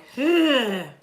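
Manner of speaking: audible high sigh. Real, not acted. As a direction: let out a sigh before moving on. Sighs before returning to topic.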